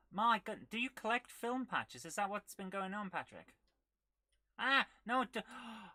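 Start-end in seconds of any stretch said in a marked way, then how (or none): 4.6-5.9 startled cry. Real, not acted. Nearly dies in game.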